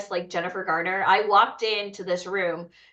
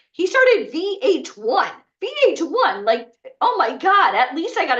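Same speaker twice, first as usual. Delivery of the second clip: much higher and louder gush; starstruck; 8 semitones higher, 5 dB louder than usual. Retold or imitated. Starstruck about meeting the VH1 founder, high and louder.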